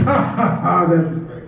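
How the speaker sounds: mocking laughter. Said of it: "and they went".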